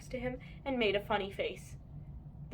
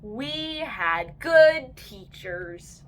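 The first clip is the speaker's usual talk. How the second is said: slow smug; 7 dB louder, much slower than usual. A narrator's reading. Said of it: "said Andrew and Taylor J".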